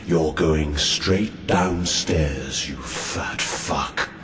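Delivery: very deep, slow monster voice; sinister demon host. Acted. Demonic host promising the heckler something odious, deep and menacing. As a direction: drag each word out from the depths.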